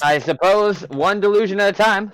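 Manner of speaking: loud, high, dismissive quip; haughty sarcasm. Acted. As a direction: brush them off with scorn. Elf dismissing the news.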